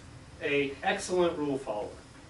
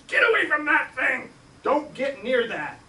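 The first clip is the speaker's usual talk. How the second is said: urgent order; 10 semitones higher, 5 dB louder than usual. Retold or imitated. Oldest sister Debbie ordering the kids away from the trap. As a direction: order them off, alarmed.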